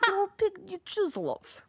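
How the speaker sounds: lightly done Eastern European lady; Eastern European accent. Acted. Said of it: "The one you do that you specialize in".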